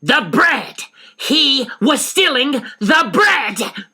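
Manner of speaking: high, loud, slow outraged cry; frenzied indignation. Acted. Missus Frank denouncing the thief, frenzied indignation. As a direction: let your outrage explode. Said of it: "In a frenzy of indignation".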